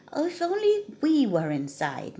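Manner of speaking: mild high moan of longing; wistful sigh of a gloomy cat. Acted. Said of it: "moaned the cat".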